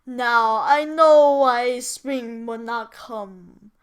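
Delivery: slow, loud remorseful cry; slow and loud, the giant's big regret. A narrator's reading. The giant crying out his regret, slow and loud. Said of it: "he cried".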